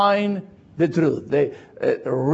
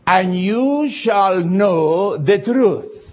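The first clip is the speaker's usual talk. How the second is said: slow, weighty "And you shall know the truth"; stress on the truth; 3 semitones higher, much slower than usual. Shown as a demonstration. Preacher reading the verse to the congregation, slow and weighty. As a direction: lean on "the" before "truth". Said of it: "why I do emphasize the word "the".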